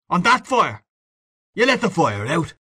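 high-pitched, outraged questioning; furious. A narrator's reading. Father rounds on son over dead fire, furious. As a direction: pitch jumps up in angry disbelief. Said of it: "The man jumped up furiously".

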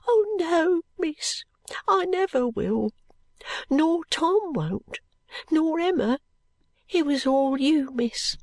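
put-on high, slow child's voice; earnest small maid promising gratefully; grateful little girl. Little maid answers with deep feeling.

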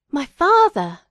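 high, loud exclamation of glad surprise; astonished cry. A narrator's reading. Prisoner learning his visitor is his father, astonished cry. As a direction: cry out in sudden amazement. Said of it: "cried I".

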